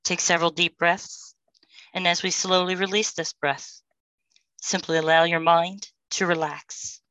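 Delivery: usual speaking voice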